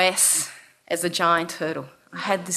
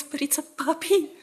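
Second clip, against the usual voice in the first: high, pleading little protest; mock tearful; 7 semitones higher than usual. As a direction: imitate students pleading close to tears. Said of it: "the students in tears in their eyes".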